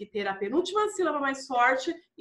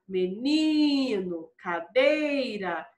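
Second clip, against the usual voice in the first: "Menino, cadeira" with NI and DEI stretched; calling the words; 3 semitones lower, much slower than usual. Shown as a demonstration. Teacher checks the strong syllable for pupils, calling the words. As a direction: call out "Menino, cadeira", holding the next-to-last syllable. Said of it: "chame a palavrinha pra ter certeza".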